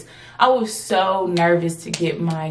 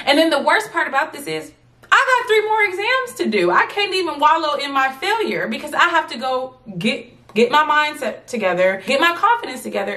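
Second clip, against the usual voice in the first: exasperated; 8 semitones higher than usual. Retold or imitated. Stress over three more exams.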